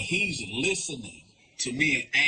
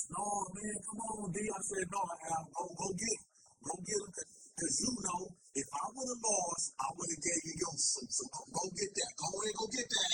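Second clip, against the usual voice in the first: much softer, higher hushed back-and-forth; soft, high secretive exchange; hushed talk; 5 semitones higher, 17 dB quieter than usual. Retold or imitated.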